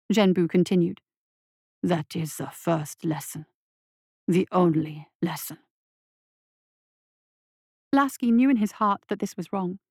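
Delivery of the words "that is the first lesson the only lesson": monster voice. A narrator's reading. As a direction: purr approval like a monster. Monster lectures boy.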